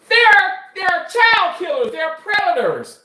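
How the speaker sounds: high, loud hammed-up indignation; mock outrage. Mimicking someone refusing to operate on prisoners, mock outrage. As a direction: cry out in exaggerated horror.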